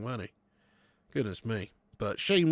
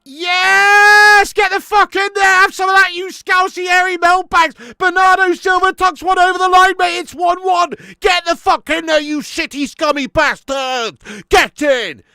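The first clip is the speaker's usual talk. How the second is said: celebration roar; 16 dB louder than usual. Real, not acted. Goal goes in, celebrating.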